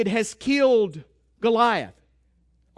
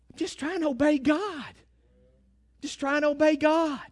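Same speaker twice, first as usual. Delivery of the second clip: pleading protest; 4 semitones higher than usual. Acted. Voices a misunderstood believer's protest.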